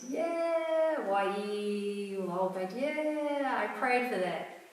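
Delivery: high, sing-song delight; half-sung excitement over the news; joyful email reply. Retold or imitated. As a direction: lilt the words like a happy tune. Reading out her joyful email.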